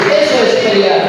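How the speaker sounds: loud commanding sermon call; preacher urging the woman of God to cry out loud; full-force preaching. Acted. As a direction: rouse the woman to pray, loud.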